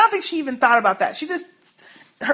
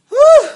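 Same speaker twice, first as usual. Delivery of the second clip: loud whew; 13 semitones higher, 10 dB louder than usual. Real, not acted. Relief after scary scene.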